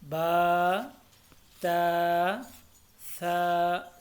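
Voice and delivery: बा, ता, सा each on a long level vowel; held one alif each. Shown as a demonstration. Teacher recites for learners, बा, ता, सा held evenly. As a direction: evenly draw out बा, ता, सा. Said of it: "तीनों को एक एक अलिफ के बराबर".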